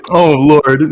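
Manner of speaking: usual speaking voice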